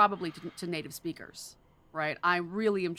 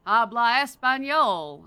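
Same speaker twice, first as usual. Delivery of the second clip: louder, slower, higher mock gringo "Habla español"; clumsy American Spanish; 5 semitones higher, 10 dB louder, slower than usual. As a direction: ham up a tourist's bad Spanish. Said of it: "sound like the American idiot".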